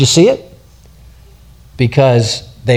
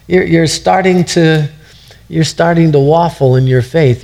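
normal talking voice

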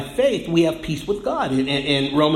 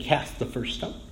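whispered, soft and low; breathy whispered scripture quote; hushed quote; 4 semitones lower, 10 dB quieter than usual. Acted. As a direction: let the verdict fall to a whisper. Quoting Jesus to the accusers.

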